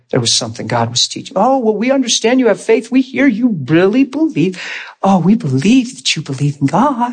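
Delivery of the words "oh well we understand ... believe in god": high, singsong patronizing voice; mock-sweet, condescending adult-to-child lilt; talking to a child. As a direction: humor them as if they were a little kid. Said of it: "they start talking like a child".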